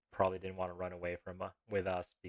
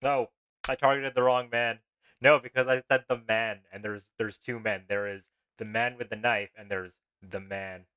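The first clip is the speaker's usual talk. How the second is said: frustrated outburst; 3 semitones higher, 12 dB louder than usual. Real, not acted. Player frustrated at his mistake.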